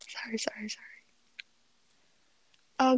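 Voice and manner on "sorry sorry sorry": whispered. Real, not acted. Whispered teasing apology.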